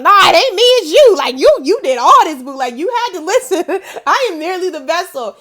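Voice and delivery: high, loud, excited imitation of grateful people; hammed-up gushing with laughs; gushing praise. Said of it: "they're like".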